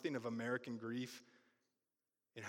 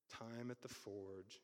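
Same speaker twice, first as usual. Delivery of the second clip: "Time at the Forge" said much softer and slow; soft, slow repeat; 2 semitones lower, 11 dB quieter, slower than usual. Shown as a demonstration. Repeating the feature's name to the congregation, hushed and slow. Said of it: "I'm calling it Time at the Forge".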